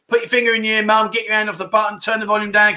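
playful public warning, pitch up; mock warning. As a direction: build up to a big noise, pitched high. Mock warning before his catchphrase.

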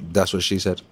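normal talking voice

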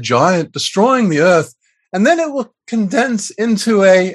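hammed-up fake Liverpool lilt, pitched high; bad Liverpudlian accent. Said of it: "read this in a really bad Liverpudlian accent".

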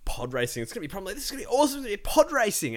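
high, building giddy hope; excited. Retold or imitated. Retold rising excitement.

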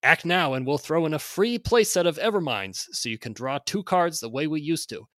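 loud, high hard-sell pitch; carnival barker. Acted. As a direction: hype it like a fairground barker. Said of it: "read this in sort of a carnival barker".

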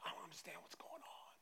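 hushed, whispery bewilderment; confused. Acted. Disciples all confused by Jesus, bewildered whisper. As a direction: whisper it as if lost. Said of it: "their mind's all messed up. They're all confused".

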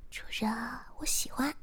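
thin little voice, rising high at the end; shy, tiny confession; small soft voice. A narrator's reading. Shiya nestled in his arms confessing, small voice. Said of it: "细声地说".

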